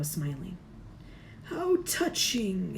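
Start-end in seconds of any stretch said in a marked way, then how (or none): 1.4-2.8 evil face hissing mockery; hissed. A narrator's reading. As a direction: sneer it out slowly. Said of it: "it hissed".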